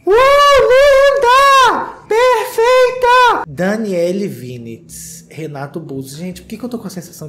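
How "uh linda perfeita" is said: shrieked high cheering; screaming cheer. Real, not acted. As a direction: scream like a fan at the runway. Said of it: "Eu quero gritos".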